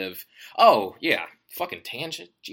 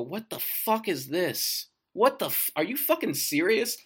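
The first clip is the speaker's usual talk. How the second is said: shocked reader; 8 semitones higher than usual. Retold or imitated. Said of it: "people look at the book and go".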